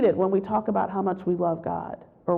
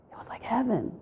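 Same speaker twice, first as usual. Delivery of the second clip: whispering; 3 semitones higher, 8 dB quieter than usual. Real, not acted. Awe at the book-filled room.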